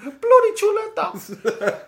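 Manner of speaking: high, hammed-up English accent; mock English accent. Host jokes to his cohost in an overdone English accent. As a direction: overplay a Cockney bloke, stretching chuleta. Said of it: "with an English accent".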